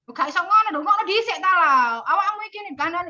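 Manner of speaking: omelan Jawa keras, nada melonjak tinggi; langsung ngegas. Acted. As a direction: semprot teman rapat dengan suara tinggi dan lantang. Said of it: "cuman gara-gara itu kamu langsung". (ketua memotong pendapat teman, marah-marah)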